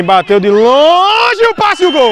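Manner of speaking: drawn-out excited goal call; explosive goal commentary. Real, not acted. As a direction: stretch the goal shout long and high.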